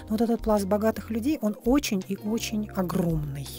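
normal talking voice

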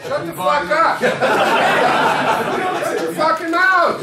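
loud, high snap at the hecklers; angry shout. Real, not acted. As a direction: yell them down, high and loud.

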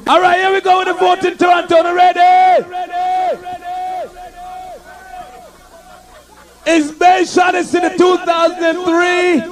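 rally the crowd like a clash MC; hype MC announcement. Acted. MC announces the voting.

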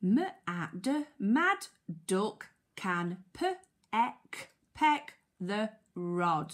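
very slow phonics reading with gaps; sounding out. Shown as a demonstration. Teacher helping children decode the sentence, sounding out. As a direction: break "mad" and "peck" into sounds, then blend them.

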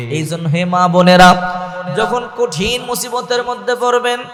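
usual speaking voice

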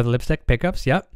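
normal talking voice

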